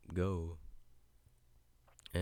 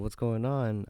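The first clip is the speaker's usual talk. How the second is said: loud, higher baffled question; retold confusion; 5 semitones higher, 9 dB louder, slower than usual. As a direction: re-enact being thrown, voice raised. Retelling own confused reaction.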